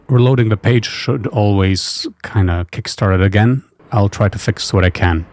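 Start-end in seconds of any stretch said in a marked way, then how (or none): none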